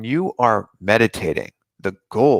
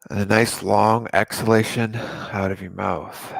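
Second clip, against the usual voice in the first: guided meditation. Acted. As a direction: ease them into calm breathing, unhurried.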